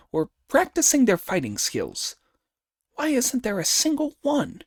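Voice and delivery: high, animated monkey musing aloud; Monkey, exclaiming. Acted. Monkey alone on the deserted mountain, exclaiming. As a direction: puzzle it out in a high, lively voice.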